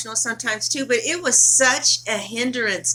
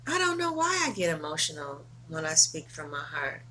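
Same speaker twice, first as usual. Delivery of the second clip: much softer and lower, trailing away; quiet reflection; 6 semitones lower, 10 dB quieter than usual. Real, not acted. Woman admitting she gets emotional from the heart, quietly. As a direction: say it almost to yourself.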